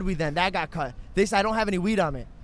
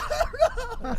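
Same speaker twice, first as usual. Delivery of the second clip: laughing reply; slower than usual. Retold or imitated. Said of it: "And I was like".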